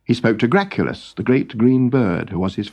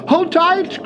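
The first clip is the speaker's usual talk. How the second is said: Noggin crying out. Acted. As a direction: shout to the crew over the storm. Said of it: "cried Noggin".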